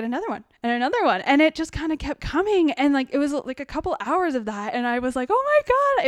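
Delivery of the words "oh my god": much higher and louder, breathless with delight; elated gasp. Retold or imitated. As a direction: let the joy spill over. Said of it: "this elated feeling".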